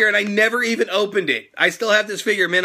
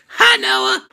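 much higher and louder cartoon greeting; Super Cena voice; 4 dB louder than usual. Shown as a demonstration. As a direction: greet the fan in a big wrestler character voice. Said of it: "say my name in Super Cena's voice".

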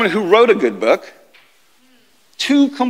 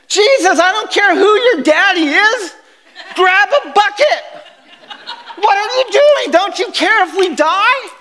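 mock angry fishermen; 11 semitones higher than usual. Disciples yelling at sleeping Jesus to grab a bucket, mock outrage. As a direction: play the soaked fisherman yelling at him.

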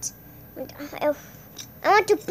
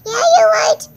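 much higher and louder squeak; high put-on voice; 10 semitones higher, 6 dB louder than usual. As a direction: squeak it like a cartoon. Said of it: "I was talking like this before".

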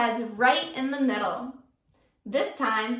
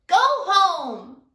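higher, louder bully shout; yelled even louder; 5 semitones higher, 8 dB louder than usual. Acted. Teacher as Sammy, shouting at Kevin to leave. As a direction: bark him away. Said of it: "Sammy yelled, even louder".

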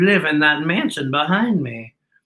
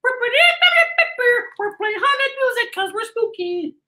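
high, slow goofy ghost-band voice; high, slow playful ghost voice; silly spooky band; much slower than usual. Retold or imitated. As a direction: squeak it out like a cartoon ghost. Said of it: "They're like".

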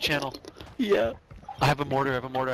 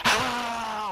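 drawn-out mock scream, higher pitched; imitated scream; 8 semitones higher than usual. Player retelling the enemy's scream in death comms, mock scream. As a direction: imitate a man yelling out as he gets hit. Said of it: "He screamed in the death comms".

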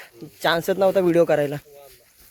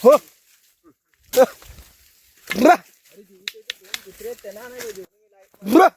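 calling out; 6 semitones higher, much slower than usual. Real, not acted. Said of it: "आवाज देत आलो".

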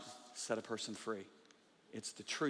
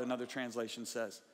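usual speaking voice

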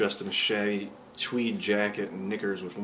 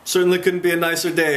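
higher, louder, lightly acted cheer; hearty agreement; 7 semitones higher, 6 dB louder than usual. A narrator's reading. Milo agreeing with his companions, lightly acted hearty cheer. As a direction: agree out loud with a touch of cheer.

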